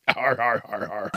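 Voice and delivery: mock pirate chuckle dropping away; pirate laugh. Punchline of a pirate joke, lightly voiced pirate har-har. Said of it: "What do you call a pirate".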